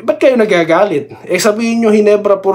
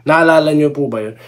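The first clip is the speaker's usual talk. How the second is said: ordinary talk, nothing marked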